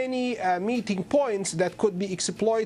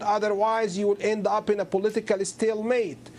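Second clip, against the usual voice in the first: normal talking voice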